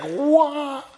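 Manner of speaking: high-pitched, stunned outburst; astonished. Acted. Preacher playing a reader amazed by Jesus, astonished. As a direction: blurt it in mock amazement. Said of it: "You're just like".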